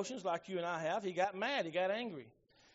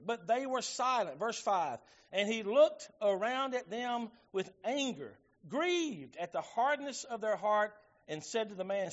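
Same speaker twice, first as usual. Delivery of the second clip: slow, raised pulpit reading with weighted words; scripture reading; 4 semitones higher, 4 dB louder, slower than usual. A narrator's reading. Preacher reading how Jesus looked round with anger, slow emphatic scripture reading. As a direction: declaim the verse slowly, leaning on each phrase.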